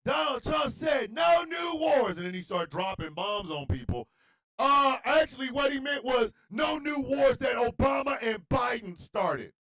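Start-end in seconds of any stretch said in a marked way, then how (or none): 0.0-2.2 high, slow, loud indignant mock supporter; mocking a supporter's shrill defense; mock Trump supporter. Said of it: "The Trump supporter said". 4.6-9.5 high, loud smug correction; hammed-up excuse-making supporter; mock know-it-all supporter. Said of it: "The Trump supporter said".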